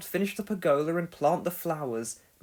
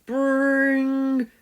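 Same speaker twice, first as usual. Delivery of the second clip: a high, louder imitated phone ring; 9 semitones higher, 7 dB louder than usual. A narrator's reading. The phone rings while Pilchard sleeps, an imitated ring. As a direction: ring it out like an old telephone.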